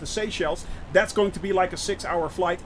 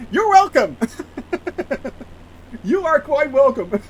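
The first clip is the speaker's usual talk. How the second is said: laughing welcome; 6 semitones higher, 6 dB louder than usual. Real, not acted. Thanked by chat, laughing.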